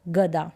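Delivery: गदा said plain, no nasal sound; गदा without nasal. Shown as a demonstration. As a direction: say गदा with no bindu. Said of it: "क्या होगा इसका उच्चारण". (बिना अनुस्वार के गदा)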